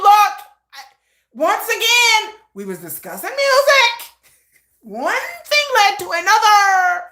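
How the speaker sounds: high, loud brush-off; shameless excuse. Retold or imitated. Mother excusing herself to her son, high and loud. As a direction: shrug it off brightly.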